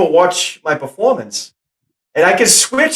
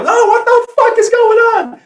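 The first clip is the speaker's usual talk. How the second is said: much higher, shocked outburst; sudden outburst; 3 dB louder than usual. Shown as a demonstration. Actor demos his movie character losing it, outraged outburst. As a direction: snap from calm into outrage. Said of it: "I start off very calm. And I go".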